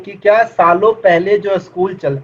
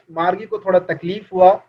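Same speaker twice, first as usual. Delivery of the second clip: normal talking voice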